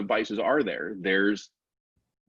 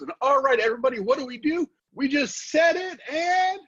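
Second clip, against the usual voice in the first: much higher, hyped-up infomercial pitchman; Ron Popeil impression. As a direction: work up the studio crowd, high and bright. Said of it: "when he's looking out to the crowd and goes".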